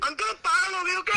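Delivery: real high-pitched panicked protest; panicked yelling. Real, not acted. Prank victim begging uncle not to expose him, panicked yelling. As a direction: cry out in alarm, pitch shooting up. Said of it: "चिल्ला क्यों रहा है".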